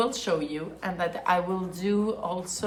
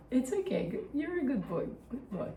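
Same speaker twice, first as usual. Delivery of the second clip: soft, high praise for a dog; soothing a scared dog; 6 semitones higher, 7 dB quieter, faster than usual. Real, not acted.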